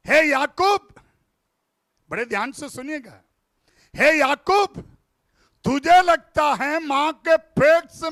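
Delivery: loud, high preaching, calling out to Jacob; preacher confronting Jacob; fiery preaching. Acted. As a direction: thunder it from the pulpit. Preaching at full force.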